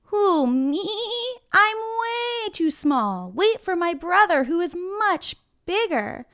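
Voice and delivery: high, drawn-out tiny goat voice; little goat. Acted. Littlest goat tells troll to wait for brother, high goat voice. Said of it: "What did that goat say?".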